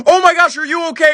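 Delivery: high, urgent mock alarm; alarmed bystander. Woman rushing up to the overturned car, alarmed. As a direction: call out high in mock concern.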